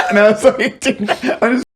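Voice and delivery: high giggly voice; laughing. Real, not acted. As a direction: laugh through the words.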